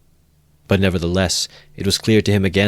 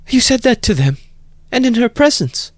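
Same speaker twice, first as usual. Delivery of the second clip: put-on high, louder frightened young woman; frightened cry; 5 dB louder than usual. A narrator's reading. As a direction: cry out in alarm. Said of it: "cried Sonia, frightened".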